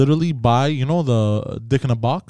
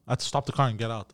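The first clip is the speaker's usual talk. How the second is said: ordinary talk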